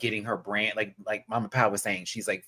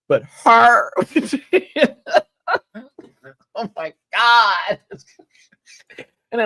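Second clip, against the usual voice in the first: high, loud laugh breaking through; 11 semitones higher, 10 dB louder than usual. Real, not acted. Owner laughing about her loud cat, high and loud. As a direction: crack up at her wild cat.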